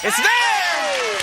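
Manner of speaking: high-pitched cry of triumph; excited announcement. Real, not acted. Host revealing the right answer to contestants, excited high call.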